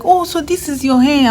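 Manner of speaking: high, surprised question; surprised lab mate. Retold or imitated. Lab mate seeing the speaker's natural hair, high and surprised. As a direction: pitch it up in wonder.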